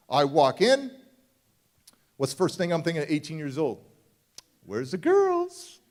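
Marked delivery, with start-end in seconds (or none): 4.6-5.8 put-on much higher, slow, drawn-out "Where's the girls?"; high teen squeak. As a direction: squeal it like a girl-crazy eighteen-year-old. Teen self eyeing girls at course.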